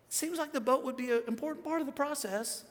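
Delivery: high-pitched tentative protest; mock puzzled objection. Acted objection to losing boat.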